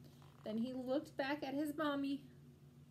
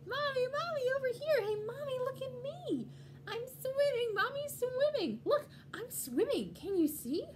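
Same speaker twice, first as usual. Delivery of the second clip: put-on very high, loud, excited child voice; little penguin's voice; 14 semitones higher, 7 dB louder than usual. Little penguin shows mommy the swimming, thrilled child voice. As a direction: squeal out to mommy with delight. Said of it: "came a little voice she knew".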